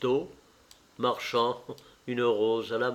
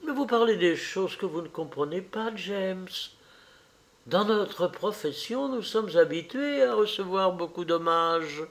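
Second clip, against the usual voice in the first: a lightly voiced fussy mother, higher; the mother lecturing her son, a touch higher; mother's voice; 6 semitones higher than usual. The mother's long reply. (la mère qui sermonne son fils, voix un peu plus aiguë)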